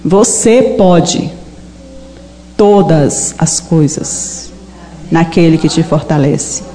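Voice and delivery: voice of Jesus. Retold or imitated. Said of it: "Jesus falou pra mim".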